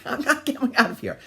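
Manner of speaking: put-on high, loud little-kid refusal; mock defiant child. Child refusing mother through bathroom door, mock defiant. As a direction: play a kid digging in his heels.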